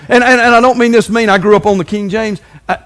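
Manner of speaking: ordinary talk, nothing marked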